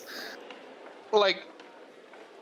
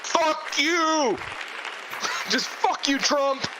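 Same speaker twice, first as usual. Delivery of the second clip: angry yelling; 8 semitones higher, much slower than usual. Acted. Yelling at Trump on TV.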